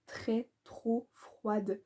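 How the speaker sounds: slow whispered verdict. Acted. Booktuber admitting the writing feels cold, whispered slowly. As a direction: breathe it out slowly as a confession.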